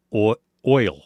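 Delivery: repeating oil as a demo; saying oil again. Shown as a demonstration. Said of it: "how people pronounce oil".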